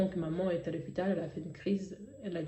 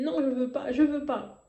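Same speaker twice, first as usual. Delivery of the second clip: higher-pitched, mildly cried protest; retold cry of refusal; 5 semitones higher, 3 dB louder than usual. Daughter in a dream refusing her father, mildly crying out. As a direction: protest twice, voice raised in pitch. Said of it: "J'ai crié".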